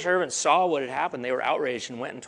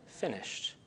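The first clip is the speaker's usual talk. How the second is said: hushed whisper; 4 semitones lower, 12 dB quieter than usual. Acted. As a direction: whisper the word finished, barely audible. Jesus's last words on the cross.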